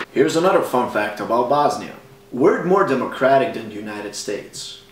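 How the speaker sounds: ordinary talk, nothing marked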